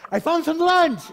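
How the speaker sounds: high, loud mock shout of discovery; excited shout. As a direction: call it out at the top of your voice. Acted disciple calling out to the Lord.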